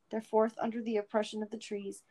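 ordinary delivery, nothing marked

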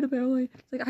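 usual speaking voice

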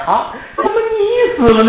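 high, slow wailing lament; keen over the dead child; wailing in grief. Acted. Father wailing over his dead daughter's body. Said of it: "放声痛哭".

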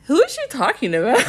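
high, quick, puzzled question; baffled reaction. Retold or imitated. Viewer reacting to a baffling contestant, baffled.

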